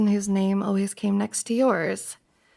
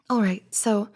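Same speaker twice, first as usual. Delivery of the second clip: ordinary talk, nothing marked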